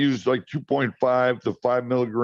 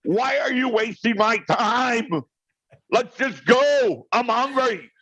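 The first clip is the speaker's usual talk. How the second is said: higher, mock-exasperated rant; exasperated outburst; 11 semitones higher than usual. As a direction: blow up at her, half joking. Retold outburst at wife over dinner.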